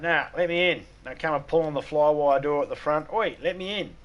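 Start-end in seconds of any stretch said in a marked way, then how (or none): 0.0-0.9 higher pleading cat; cat whining at the door; whiny cat voice. Retold or imitated. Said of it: "They're like". 3.1-4.0 higher pleading cat; cat whining at the door; whiny cat voice. Retold or imitated. Cats at front door.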